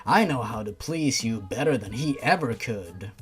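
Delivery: low, sleazy lightly put-on voice; creepy Jeff. Jeff pushing the heroine to dump Toby, low sleaze. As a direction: purr it like a bad seducer.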